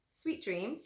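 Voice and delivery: ordinary delivery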